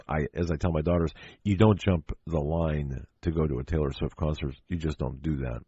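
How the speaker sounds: ordinary talk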